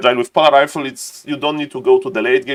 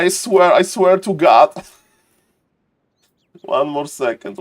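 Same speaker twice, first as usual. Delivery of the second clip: ordinary talk, nothing marked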